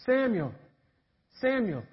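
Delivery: soft, falling call; voice calling a name. Acted. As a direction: call out gently, as if from afar. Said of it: "And the voice says".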